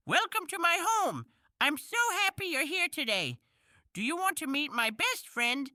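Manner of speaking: plain reading voice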